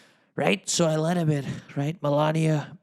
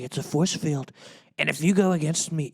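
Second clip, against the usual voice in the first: ordinary delivery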